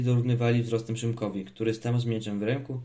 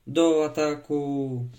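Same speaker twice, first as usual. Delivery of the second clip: long-drawn, louder, higher call to charge; battle cry; 5 semitones higher, 5 dB louder, much slower than usual. A narrator's reading. Boy on horseback sends his knights charging, drawn-out battle cry. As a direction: call the troops forward, stretching the words slowly. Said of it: "pokazując meczem przed siebie zawołał".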